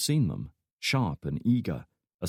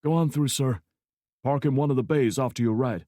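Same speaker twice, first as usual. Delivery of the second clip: gate guard voice; 5 semitones higher than usual. A narrator's reading.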